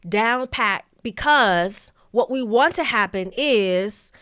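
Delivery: loud, drawn-out words with pauses between phrases; elongated overemphasis. Shown as a demonstration. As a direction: overdo it, stretching REALLY, OF and IS slowly and loudly. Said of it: "emphasis can be elongating a word".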